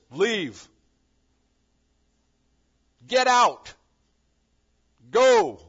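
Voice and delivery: loud booming divine command; stern shouted order, each word its own blast; strong, harsh command. Acted. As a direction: bark it out like a voice from heaven. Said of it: "Strong, harsh".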